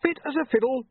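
high, panicky wail; desperate high-pitched protest. A narrator's reading. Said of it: "William wailed".

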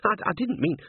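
high, stumbling excuse; high-pitched, stammering apology; flustered babbling. Acted. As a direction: trip over the words in embarrassment. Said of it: "the words tumbled over themselves".

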